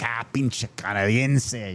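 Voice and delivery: slow, drawn-out jeer in Spanish; lightly voiced mocking Mexican jeer; mock insult. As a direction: sneer it like a scornful local. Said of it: "they're like".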